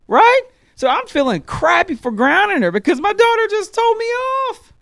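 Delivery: yelling in comic disbelief at being outsmarted; outraged disbelief. Acted.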